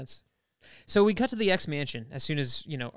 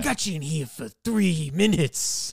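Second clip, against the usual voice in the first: louder, slow, menacing villain voice; menacing Archangel; 3 semitones higher, 5 dB louder, slower than usual. Retold or imitated. Archangel stopping the workers from leaving, slow menacing voice. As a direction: drag out each threat like a bully.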